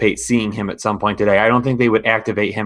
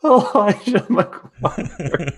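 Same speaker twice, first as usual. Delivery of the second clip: high, drawn-out name through laughter; real laughter; 10 semitones higher, much slower than usual. Real, not acted. Co-host answering a fantasy football question, laughing. Both hosts cracking up at name.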